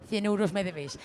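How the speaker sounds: ordinary talk, nothing marked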